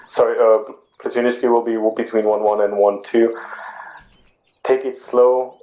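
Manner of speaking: ordinary talk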